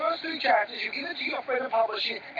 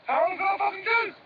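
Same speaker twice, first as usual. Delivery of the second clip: angry outburst; 10 semitones higher, 4 dB louder than usual. Acted. Heated argument between brothers.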